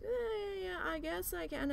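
high, drawn-out mock reluctance; reluctant mother-in-law. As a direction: mimic her grudging agreement up high. Mother-in-law's reluctant answer.

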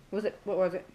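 ordinary talk, nothing marked

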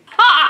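sharp high laugh; a real loud laugh at herself; loud burst laugh. Real, not acted. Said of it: "laughing at herself".

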